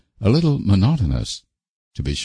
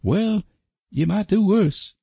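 very high country-fellow voice; high-pitched, protesting suitor; expostulating rustic suitor. A narrator's reading. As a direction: plead your case in a high rustic voice. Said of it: "expostulated Sam".

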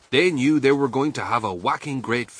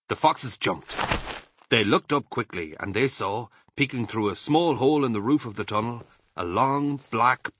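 ordinary delivery

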